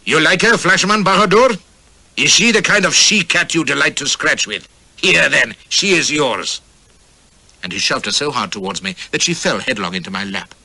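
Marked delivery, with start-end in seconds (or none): 0.0-6.6 higher, louder leering khan voice; a drunk khan offering the dancing girl, mocking; cackling tyrant. A narrator's reading. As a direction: taunt the guest with wicked glee. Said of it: "Sher Afzal cackled and said to me".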